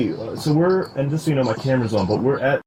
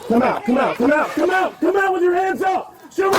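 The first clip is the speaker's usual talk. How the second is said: shouting commands. Real, not acted. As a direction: yell orders at an armed man. Officer orders suspect out.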